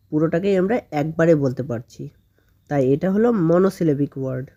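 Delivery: usual speaking voice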